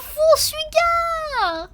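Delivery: high, loud, long-drawn wail; dramatic realisation. Real, not acted. Streamer suddenly reading the missing ingredient in the recipe, wailing.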